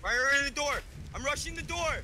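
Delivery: high urgent shout while charging in; excited shouting. Real, not acted. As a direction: yell it as you rush the door. Storming enemy door in game.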